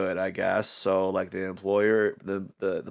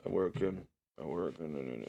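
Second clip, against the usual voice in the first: half-awake; 3 semitones lower, 14 dB quieter than usual. Retold or imitated. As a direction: drift through the words barely conscious. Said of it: "semi-awake".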